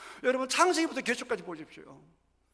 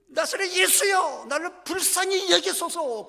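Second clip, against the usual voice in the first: pleading cry; 9 semitones higher, slower than usual. Retold or imitated. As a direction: call out across the crowd, begging. Blind man crying out to Jesus.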